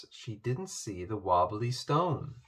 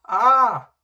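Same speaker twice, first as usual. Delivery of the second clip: loud, high startled shriek; a sharp high cry of alarm; shriek of fright; 11 semitones higher, 13 dB louder than usual. A narrator's reading. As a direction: scream at the sudden sight. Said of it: "she cried".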